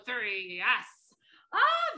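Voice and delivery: usual speaking voice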